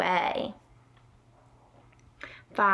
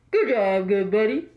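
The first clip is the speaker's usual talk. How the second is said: truck driver radio voice; 2 semitones lower than usual. Pretend. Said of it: "the truck driver cheer".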